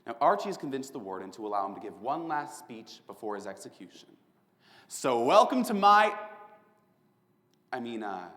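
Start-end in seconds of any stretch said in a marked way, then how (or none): 4.9-6.1 showman's welcome. Acted. Showman opening his show.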